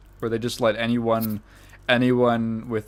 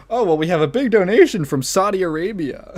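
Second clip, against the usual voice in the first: mock announcer; 6 semitones higher, 5 dB louder than usual. Mock Clinton Foundation announcer.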